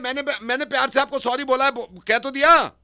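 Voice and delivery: high-pitched indignant protest, stretched at the end; exasperated insistence. Pretend. Caller insisting he apologized lovingly, high exasperated protest. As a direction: insist shrilly that it was already said.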